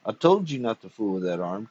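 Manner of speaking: usual speaking voice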